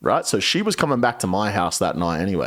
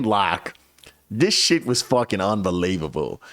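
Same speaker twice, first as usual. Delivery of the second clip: ordinary talk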